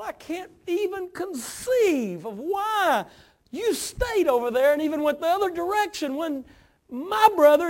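high, strained cry of grief and reproach; anguished pleading. Acted. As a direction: act out a bereaved sister protesting to Jesus. Mary's anguished complaint at Jesus' feet.

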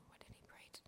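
a private, breathy inner question; whispered thought. Retold or imitated. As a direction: whisper the thought to yourself, almost no voice.

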